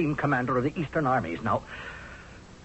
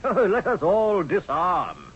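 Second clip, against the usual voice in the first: slow, higher, sing-song mock of the king; mocking the Hittite king; 6 semitones higher, 4 dB louder, slower than usual. General mocks the Hittite king's peace offer in a slow sing-song. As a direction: ham up the enemy's false sweetness, slowly. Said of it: "King Hatti told me".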